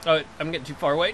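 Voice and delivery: ordinary talk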